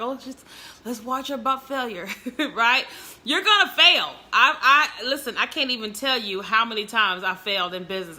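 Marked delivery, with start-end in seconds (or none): 0.0-2.1 quiet, offhand imitation of bored viewers; mock audience. Mocking her silent viewers, quiet and offhand. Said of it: "Y-, y'all just like". 3.2-4.1 higher and louder declaration; emphatic warning. Acted. Preaching failure to her live viewers, loud and emphatic.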